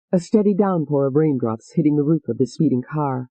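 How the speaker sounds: ordinary delivery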